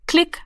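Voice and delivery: short high-pitched dial click; imitated click. A narrator's reading. The telephone dial moves under Rami's finger, imitated click. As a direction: make a quick, high click with the word. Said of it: "machte es".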